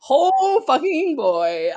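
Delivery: high, slow, drawn-out exclamation; emphatic exclamation. Real, not acted. Podcaster introducing her game chaos segment, drawn-out exclamation. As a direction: stretch every word in exasperated awe.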